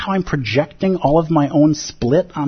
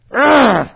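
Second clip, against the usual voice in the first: loud, high groan of frustration; exasperated ugh; 10 semitones higher, 6 dB louder than usual. Retold or imitated. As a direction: groan like the toast is gone.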